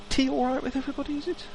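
high, fast fussing host; fussy host. Acted. Host counting guests and offering tea, high quick voice. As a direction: bustle about offering tea. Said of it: "NPC host offering tea".

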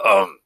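professor's showy ahem; loud throat clearing. Acted. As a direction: clear the throat loudly before a speech. Professor clears throat before speech.

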